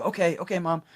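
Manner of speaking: put-on high, meek little girl giving in; child voice. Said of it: "you go".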